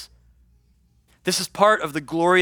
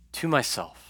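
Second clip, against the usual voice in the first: quiet echo of to myself; 3 semitones lower, 6 dB quieter than usual. Shown as a demonstration. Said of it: "Look at these next two words".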